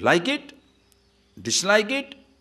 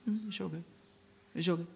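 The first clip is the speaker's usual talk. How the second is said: much softer, higher, pleased; contented murmur; 4 semitones higher, 12 dB quieter, faster than usual. Acted. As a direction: hum it out satisfied. Voicing a pleased reaction.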